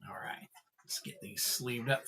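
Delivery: soft whisper to himself. Real, not acted. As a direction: breathe the words out, barely voiced. Quietly sleeving cards on stream.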